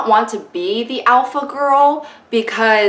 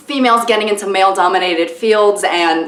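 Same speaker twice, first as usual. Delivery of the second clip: usual speaking voice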